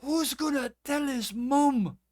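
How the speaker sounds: high, loud, demanding Scotsman; Scottish man pressing the question; broad Scottish brogue, insistent; Scottish accent. Acted. GM plays Callum.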